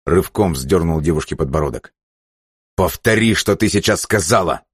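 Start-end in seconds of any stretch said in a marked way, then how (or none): 2.7-4.6 raised, threatening insistence; angry demand. Acted. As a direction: demand it with the pitch pushed up. Разгневанный Джерри требует повторить. (гневное требование)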